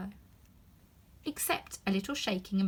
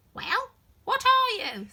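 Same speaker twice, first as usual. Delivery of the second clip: higher, louder grumbling bird; complaining pigeon; 8 dB louder, slower than usual. Acted. Pigeon grumbling about serpents, higher and louder. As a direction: sigh out your grievance.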